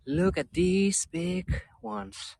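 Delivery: usual speaking voice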